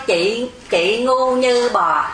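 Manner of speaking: nói chậm từng chữ, như chỉ là âm thanh; buông câu chửi thật chậm, không cảm xúc; chậm rãi, thản nhiên. Shown as a demonstration. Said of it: "vì vậy âm thanh thôi". (đọc chậm, coi như âm thanh suông)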